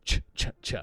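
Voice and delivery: repeated ch, ch, ch hissed without voice; voiceless ch sound, three times; crisp whispered ch ch ch; ch sound drilled. Shown as a demonstration.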